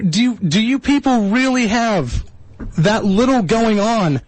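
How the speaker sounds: ordinary talk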